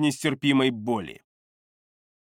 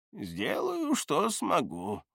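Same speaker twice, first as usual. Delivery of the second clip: put-on old man voice; 3 semitones higher, 8 dB quieter, slower than usual. Gerton promises, meaning hidden.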